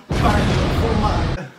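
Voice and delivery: loud shout on the punch. Real, not acted. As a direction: bark it out as you hit. Throwing a hard punch.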